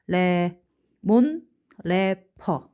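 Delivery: slow dictation with gaps between words; deliberate word-by-word recital; memorization mnemonic. Shown as a demonstration. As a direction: separate each syllable for memorizing. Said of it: "이렇게 외우시면 되는데요".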